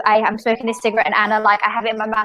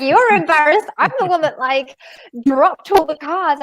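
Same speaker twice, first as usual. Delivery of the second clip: indignant retort; 6 semitones higher, 3 dB louder than usual. Retold or imitated. Retold indignant retort to upset friend.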